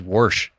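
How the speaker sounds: warsh with an r sound, said wɔɹʃ; Southern warsh. Retold or imitated. Churchgoer says warsh in the sermon, wɔɹʃ with an r. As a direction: say warsh rhyming with porsche. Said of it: "'cause he was from Southern Illinois".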